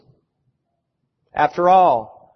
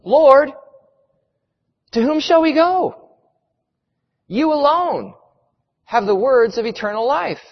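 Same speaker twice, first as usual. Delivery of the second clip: high, earnest declaration; pleading, pitched-up profession of faith; proclaiming; 7 semitones higher than usual. A narrator's reading. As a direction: proclaim it to the Lord with rising fervour.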